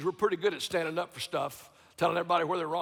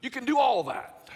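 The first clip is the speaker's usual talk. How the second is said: higher, louder preacher cry; emphatic call; 7 semitones higher, 6 dB louder than usual. Real, not acted. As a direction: throw it out to the crowd. Sarcastic shout after mockery.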